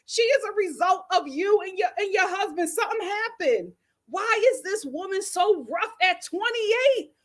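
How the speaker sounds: high-pitched, incredulous telling-off; indignant confrontation, retold with heat; exasperated scolding. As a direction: take the mother to task, pitch up. Acted scolding of the mother.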